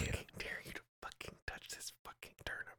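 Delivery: hushed taunting dare; whisper. Pretend.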